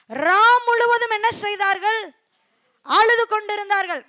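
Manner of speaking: loud, high scripture reading; scripture proclaimed. Acted. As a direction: proclaim the verse. (உரத்த வேத வாசிப்பு)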